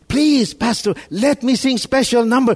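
high-pitched eager begging; mock plea to be allowed to serve; pleading. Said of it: "imagine somebody pleading".

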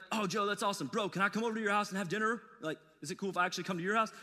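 rushed, breezy bro invitation; mock overeager invite. Preacher imitating a pushy stranger, fast bro talk. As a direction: fire it off fast and casual. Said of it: "I'm definitely not gonna be like".

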